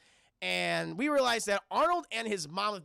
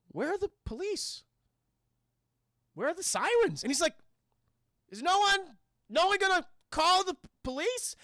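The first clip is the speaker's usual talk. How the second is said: higher, incredulous calls for the police; baffled disbelief; 9 semitones higher, 4 dB louder than usual. Retold or imitated. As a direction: act stunned that no sirens come. Charles Dance baffled, no sirens.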